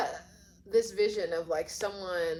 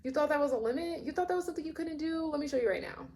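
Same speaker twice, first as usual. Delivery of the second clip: much higher, playful and teasing; high excited challenge; 9 semitones higher, faster than usual. Acted. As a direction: tease them that their limit is fake. Acted eager partner, rhetorical challenge.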